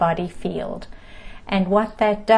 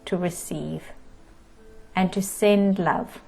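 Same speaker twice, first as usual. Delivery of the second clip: guided meditation. Acted. Teacher settling the group into breath and body, guided meditation. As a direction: ease listeners into stillness, calm and unhurried.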